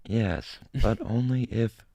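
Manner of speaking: slow, lightly mechanical robot voice, not far from his own; deadpan machine reply, slowed down. Pretend. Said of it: "in the robot voice".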